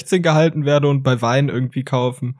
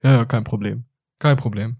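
a hint of meek, lower compliance; meek reply; 4 semitones lower than usual. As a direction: swallow it and say it's fine, lower. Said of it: "Was ich dann sag ist". (kleinlaut und etwas tiefer)

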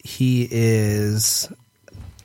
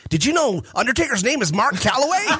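naive fan voice; 11 semitones higher, faster than usual. Retold or imitated. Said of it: "Our dirt sheet was like".

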